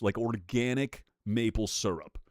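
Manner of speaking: ordinary delivery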